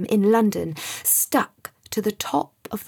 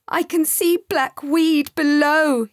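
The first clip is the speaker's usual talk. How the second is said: high, slow, loud frightened shout; 7 semitones higher, 5 dB louder than usual. Acted. Scared boy shouting to Sam, frightened. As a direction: shout out the danger below. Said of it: "he shouted".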